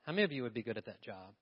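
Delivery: ordinary talk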